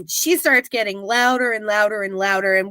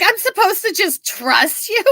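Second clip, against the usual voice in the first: skeptical; 8 semitones higher than usual. Retold or imitated. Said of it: "she's like".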